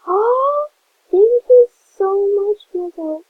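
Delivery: high, loud, gasping thank-you; thrilled thanks. Real, not acted. Streamer thanking a fan for a gift, high excited squeal. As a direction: squeal your thanks, breathless with delight.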